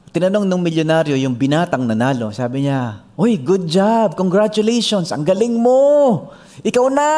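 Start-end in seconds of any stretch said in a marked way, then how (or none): 3.1-7.1 millionaire praising. Retold or imitated. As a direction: congratulate him grandly. Millionaire congratulates winner.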